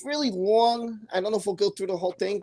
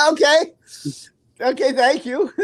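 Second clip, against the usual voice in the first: high words through real laughter; laughing; 6 semitones higher, 5 dB louder than usual. Real, not acted. Host thanks commenter about his accent, laughing. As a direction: accept it while cracking up.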